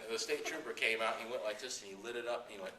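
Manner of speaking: ordinary talk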